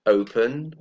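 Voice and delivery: normal talking voice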